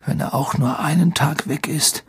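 high, husky half-whisper; worried, breath-heavy warning; hoarse. A narrator's reading. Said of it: "sagte er heiser".

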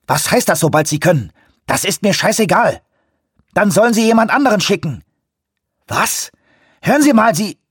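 high, indignant shouting; angry phone argument. A narrator's reading. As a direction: argue furiously with the person on the line. Furious phone quarrel with coastguard.

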